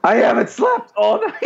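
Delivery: high mock-frantic outburst; nervous. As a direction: blurt it out, comically overwrought. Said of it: "I'm so nervous".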